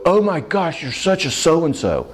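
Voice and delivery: a lightly played accusing outburst; mock exasperated outburst. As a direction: ham up the fed-up spouse. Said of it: "nor am I gonna go".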